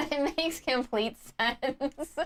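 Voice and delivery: slow words through laughter; laughing. Real, not acted. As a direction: chuckle through it. Laughing at the joke.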